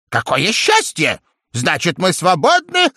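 high happy parrot cry; joyful cry. A narrator's reading. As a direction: shout for joy. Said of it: "закричал попугай и от радости". (радостный крик попугая)

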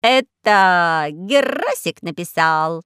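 raspy cawing crow. Acted.

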